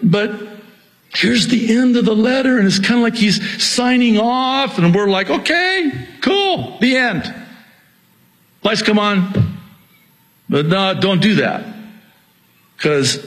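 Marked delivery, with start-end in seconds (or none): 5.3-7.3 high-pitched mock cheerful sign-off; breezy dismissal. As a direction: imitate people happily checking out early. Acting listeners eager to leave.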